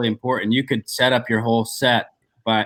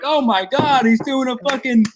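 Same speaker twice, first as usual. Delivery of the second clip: excited American fan; 10 semitones higher than usual. Retold or imitated. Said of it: "everyone's like".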